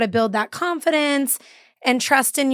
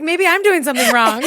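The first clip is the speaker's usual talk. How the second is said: higher, louder mock-worried quote; mock panic; 5 semitones higher, 5 dB louder than usual. Imagining confused mom doubting herself, in mild mock panic. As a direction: playfully imitate someone starting to panic.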